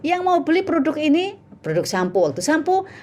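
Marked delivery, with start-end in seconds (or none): 0.0-1.4 high singsong seller's invitation; sales pitch. Retold or imitated. Seller inviting women to buy a product, high sales pitch. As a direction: pitch it high to passing customers. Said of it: "Saya ini".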